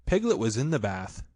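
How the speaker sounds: plain reading voice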